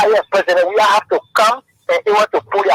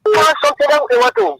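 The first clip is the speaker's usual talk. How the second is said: quoting a leader; 12 semitones higher than usual. Retold or imitated. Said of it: "he said".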